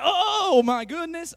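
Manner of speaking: loud, high, drawn-out gush; mock amazement. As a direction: stretch it out in hammed-up wonder. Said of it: "you could just be like".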